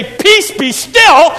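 Jesus calling out slowly to the storm; commanding Jesus. Acted.

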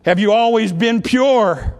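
loud, slow, lofty voice asking from on high; questioning voice from the throne; voice from the light. Retold or imitated. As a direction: boom it down like a voice from heaven. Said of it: "The voice came out of the bright light again".

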